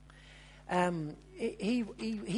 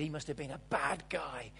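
mock scolding; 4 semitones lower, 7 dB louder, slower than usual. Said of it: "we all jumped to conclusions".

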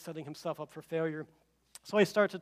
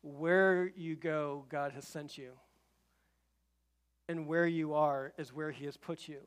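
earnest preaching. Acted. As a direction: press the truth home gently, with long pauses. Sermon application to the congregation.

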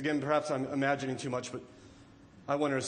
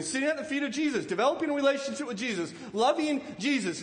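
ordinary talk

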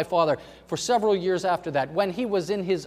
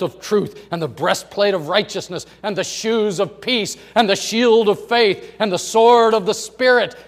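loud, building sermon cadence; full-force preaching; 3 semitones higher, 10 dB louder than usual. Real, not acted. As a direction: preach it out like a rousing battle roll call.

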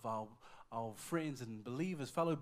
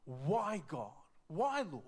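raised, pleading lament; anguished questioning; 3 semitones higher, 5 dB louder, much slower than usual. Retold or imitated. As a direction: cry out in retold anguish. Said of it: "We both cried all night thinking".

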